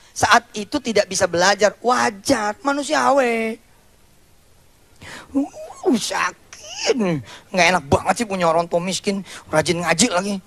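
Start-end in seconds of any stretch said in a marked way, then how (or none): 5.3-7.0 high, strained little cry of hurt; a wounded whimper on sakit; pained groan. Acted. The left-behind boy feels the sting, high pained whimper.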